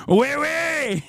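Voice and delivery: high, sing-song French oui oui; mock French accent. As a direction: chirp it like a caricature Frenchwoman. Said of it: "in the most French way possible".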